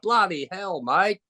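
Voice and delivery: Australian accent. Shown as a demonstration. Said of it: "I've been surrounded by Australians".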